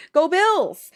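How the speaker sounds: high, loud team cheer; excited football cheer. Real, not acted. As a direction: shout it like a fan in the stands.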